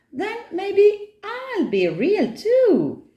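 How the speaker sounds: put-on high, slow child voice; very high-pitched, drawn-out offer; little girl sealing a bargain; eager child. Nora makes the tiger an offer.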